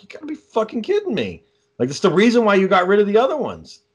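high, incredulous retold protest; re-enacted can't-believe-it complaint; exasperated disbelief. Acted disbelief at male dog.

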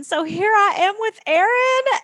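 excited. Real, not acted. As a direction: savor every word, thrilled to start. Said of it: "I'm so excited".

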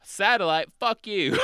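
a defiant Aussie, hammed up; Australian accent. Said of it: "there will be bad Australian accents".

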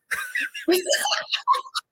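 very high squeal of laughter; real helpless shrieking laughter. Real, not acted. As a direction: burst into a high, breathless cackle. Cracking up at backdrop mix-up.

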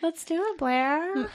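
oddly high, softened retry; weird. Acted. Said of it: "That sounded weirder".

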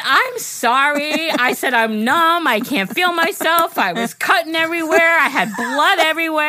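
loud, high-pitched comic self-defense; exasperated mock apology; over-the-top apology. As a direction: plead your excuses, pitched high and emphatic. Acted protest to the gyno.

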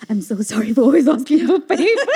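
apologising to friends for favors, laughing; laughing apology. Real, not acted. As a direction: laugh through a sheepish sorry.

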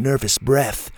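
ordinary delivery

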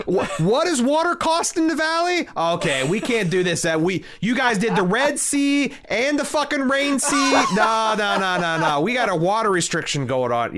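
high-pitched, incredulous budget rant; mock outrage, pitch leaping up on the questions; outraged producer. Studio boss balking at the water bill, outraged.

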